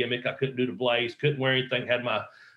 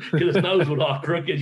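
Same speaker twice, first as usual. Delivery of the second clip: laughing; 3 semitones higher than usual. Real, not acted. Laughing at Flair's crooked nose.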